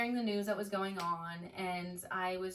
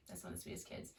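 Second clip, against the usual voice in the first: soft whispered echo; whispered repeat; 2 semitones lower, 13 dB quieter, faster than usual. Real, not acted. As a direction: whisper it back tenderly. Repeats line, then whispers it.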